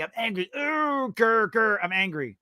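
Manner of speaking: mock a sulky kid's fake anger; mock angry. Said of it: "gets angry".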